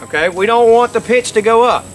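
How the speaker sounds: ordinary delivery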